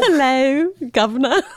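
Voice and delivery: high, hammed-up Cockney-style British greeting; mock British accent. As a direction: play at a Mary Poppins British accent. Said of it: "It feels very Mary Poppins inspired".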